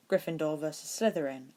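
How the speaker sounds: ordinary delivery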